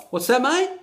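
chirpy Aussie barman asking; a high-pitched pub bloke's "What's that, mate?"; bartender's question. Retold or imitated. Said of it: "to which he replied".

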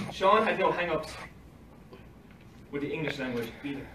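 ordinary talk

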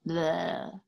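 put-on childish bleh with tongue out; raspberry noise. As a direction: blow a rude little bleh. Billy makes a face.

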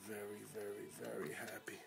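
fading into a soft, tender whisper. Real, not acted. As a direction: let the joy sink to a hush. Overjoyed dad fading to whisper.

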